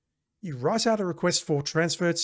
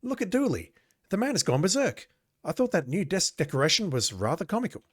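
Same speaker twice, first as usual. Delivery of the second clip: much higher, amused cop voice; a higher, tickled colleague; amused coworker; 6 semitones higher than usual. Acted. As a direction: point and chuckle at Dooley. Another coworker from game text.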